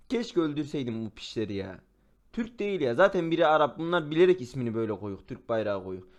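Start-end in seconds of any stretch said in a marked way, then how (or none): none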